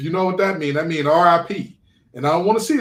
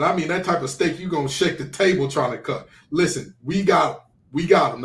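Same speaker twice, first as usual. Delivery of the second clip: ordinary talk, nothing marked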